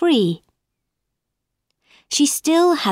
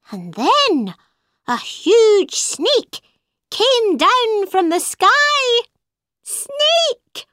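much higher bird voice, slow and looming on the huge snake; bird telling her story; 10 semitones higher, slower than usual. A narrator's reading. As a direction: build to a high, alarmed cry of Snake. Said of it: "the bird said".

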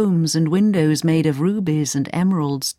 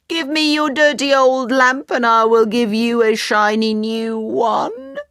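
higher hawker's call; crying out; 6 semitones higher than usual. A narrator's reading. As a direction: tempt the palace. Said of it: "and cried".

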